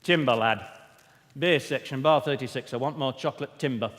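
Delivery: loud, blunt northern choirmaster calling out; northern chorus director. Retold or imitated. Choir director calling notes to the bass section, loud and blunt. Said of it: "He couldn't handle that".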